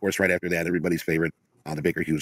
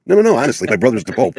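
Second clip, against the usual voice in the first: insistent barfly; 4 semitones higher, 7 dB louder than usual. Pretend. Mocking the Pope's brother at a bar.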